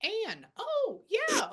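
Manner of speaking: high, loud burst of realisation; delighted recognition. Real, not acted. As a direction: exclaim as the name suddenly clicks. Medium recognises the confirmed name.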